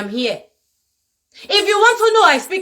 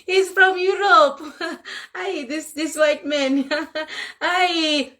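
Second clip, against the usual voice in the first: soft, slow, laughing aside; amused indulgent onlooker; 4 dB quieter, slower than usual. Retold or imitated. Mocking how locals excuse a European, soft amused chuckle. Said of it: "No, you would say".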